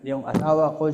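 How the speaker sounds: ordinary talk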